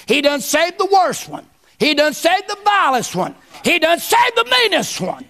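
building preacher cadence. Acted. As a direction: climb the refrain like a revival preacher.

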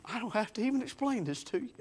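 a mother fighting back tears, speaking softly; quiet, teary mock of his mother; mother near tears. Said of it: "she's beginning to cry".

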